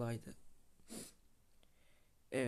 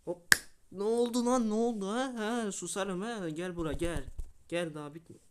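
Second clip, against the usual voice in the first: gloating shout; 8 semitones higher, 9 dB louder than usual. Real, not acted. Gloating yells at beaten opponent.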